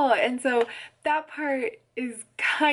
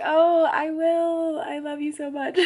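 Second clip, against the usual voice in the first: retold tearful gratitude, pitched up; mock emotional; 7 semitones higher, 3 dB louder than usual. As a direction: imitate gushing through tears, breaking into a laugh. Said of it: "so yeah, very emotional".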